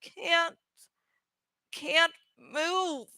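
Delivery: weak, soft, halting moan. A narrator's reading. Cassie trapped inside the creature, soft weak moan. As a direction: barely get the words out, softly. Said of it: "Cassie moaned".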